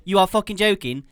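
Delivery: loud, high, exasperated rant; exasperated outburst. Retold or imitated. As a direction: raise your voice in disbelief at the machine. Retells outburst at payphone.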